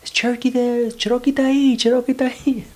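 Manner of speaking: imitating a mother calling out, high and loud; high-pitched yell for a missing kid; mom's mock yelling. Mom calling from top of park for her son, imitated yelling. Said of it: "screaming and yelling".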